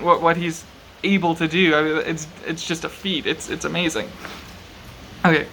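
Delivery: ordinary delivery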